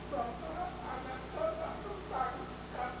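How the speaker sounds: a faint officer's voice heard through the phone; police on the phone. Pretend. Police calling a father about his arrested son, hushed and distant. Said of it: "Bayangkan Anda ditelepon polisi".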